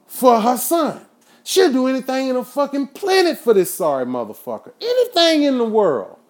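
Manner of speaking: fed up with a spoiled cousin; indignant rant. Real, not acted. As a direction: pile on each word. Ranting about spoiled cousin.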